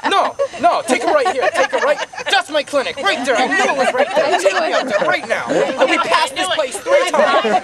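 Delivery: loud, high mock ordering; insistent grandfather. Grandfather orders driver to his clinic, loud mock insistence. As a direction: imitate an agitated grandfather giving urgent orders.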